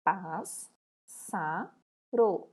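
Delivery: pássaro broken as "Pás, sa, ro", each syllable apart; split into syllables. Shown as a demonstration. Teacher spelling out pássaro for the class, syllable by syllable. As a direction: say pássaro one syllable at a time. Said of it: "Como que eu separo pássaro?".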